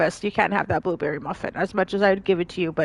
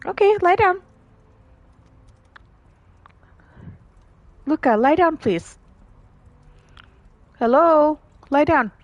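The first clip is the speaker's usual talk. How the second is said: loud, high-pitched order to a pet; dog command; 8 semitones higher, 5 dB louder than usual. Real, not acted. Owner tells stubborn dog to lie down, raised voice. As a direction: get the dog's attention and insist.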